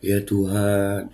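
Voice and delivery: a slow, hushed "Ya Tuhan"; quiet, stretched-out "Ya Tuhan" as a humble prayer; soft humble prayer. Shown as a demonstration. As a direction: draw out "Ya Tuhan" gently, like a sincere plea. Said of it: "gimmicknya dapat berdoa".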